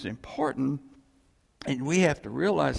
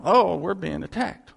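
louder, higher mock panic; mock alarm; 4 semitones higher, 7 dB louder than usual. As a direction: whine like a worried churchgoer. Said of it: "We go".

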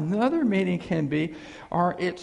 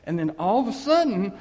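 ordinary talk, nothing marked

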